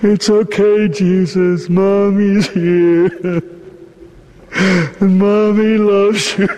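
tearful, broken tenderness; laughing through grief. Acted. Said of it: "she's holding the dead body".

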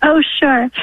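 ordinary delivery

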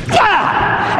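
high yell of exertion; imitated attack yell. Centurion taking a running start to strike, high attack yell. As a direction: yell out as you swing the whip. Soldiers run up swinging the scourge.

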